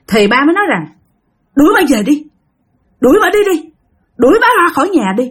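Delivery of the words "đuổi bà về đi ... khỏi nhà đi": high, insistent retold order to throw her out; agitated demand. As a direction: act out an upset husband, pitch jumping up. Husband demands the woman leave. (giọng chồng gắt gỏng đòi đuổi khách)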